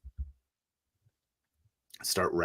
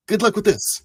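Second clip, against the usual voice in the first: high, loud, hurried parting words; awkward send-off; 7 dB louder than usual. Acted. Crewmate leaving another to do first aid, high awkward blurt. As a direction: blurt it high and make an exit.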